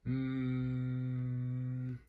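steady droning hum; fridge hum. Acted. Refrigerator hum.

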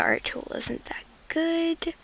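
ordinary talk, nothing marked

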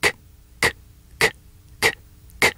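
short clicked "K" sounds, evenly spaced; letter sound k. Shown as a demonstration. Showing kids the camera-click "k" sound. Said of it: "makes a clicking sound like this".

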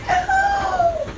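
sharp high-pitched yelp; sudden high yelp. Real, not acted. Sudden cry in sleep.

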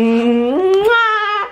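high, loud, long "mwah"; exaggerated kiss. Woman giving a playful booty kiss, exaggerated kiss sound. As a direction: hum upward into a huge smack.

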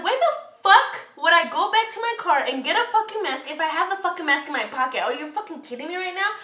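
upset outburst. Retold or imitated. Customer retelling her comeback to the store associate, upset and indignant. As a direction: fire back indignantly, reliving the argument. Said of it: "I was upset".

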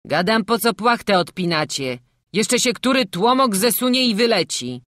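loud scolding carter. A narrator's reading. Father scolding the children over the sheet, loud. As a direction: tell them off sharply.

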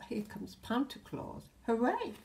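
plain reading voice

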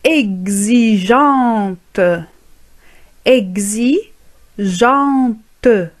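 exigeante drawn out very slowly, ending on a clear T; slow drill. Shown as a demonstration. Teacher slowly drilling students on exigeante. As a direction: stretch exigeante so learners hear each sound.

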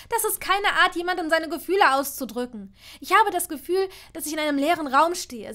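loud, high, heated outburst; temper breaking loose. A narrator's reading. Hermine says gifts replace no feelings, loud angry outburst. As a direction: let the pent-up anger pour out. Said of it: "Sie konnte ihr Temperament nicht mehr stillen".

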